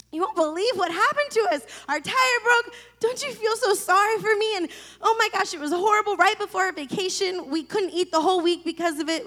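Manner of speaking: over-the-top self-pitying whine to mom; whiny complaining. Retold or imitated. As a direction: whine like a spoiled kid wanting sympathy. Whining to mom about flat tire.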